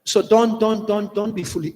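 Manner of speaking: ordinary delivery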